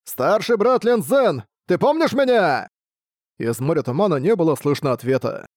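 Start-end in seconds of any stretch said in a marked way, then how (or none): 0.0-2.7 high, loud hopeful call; loud shout. A narrator's reading. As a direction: shout out into the fog. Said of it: "Мужчина громко крикнул". (громко выкрикивая, с надеждой)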